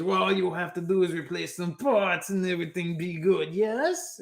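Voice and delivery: hopeful alien salesman, rising high on the question; Volto. Acted. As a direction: reassure the buyer in a put-on character voice. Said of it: "goes with his voices".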